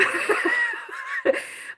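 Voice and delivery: high-pitched amused laugh. Real, not acted. Woman asked for ghost expertise, laughing it off. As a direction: laugh at the idea of being the expert.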